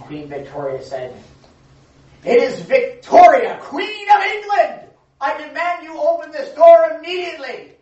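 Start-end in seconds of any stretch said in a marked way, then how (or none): 2.2-4.8 loud, high, slow royal shout; imperious demand. Acted. Queen at the door demanding entry, loud and high. 5.2-7.7 imperious demand. Acted. Queen commanding her husband to open the door, loud and imperious.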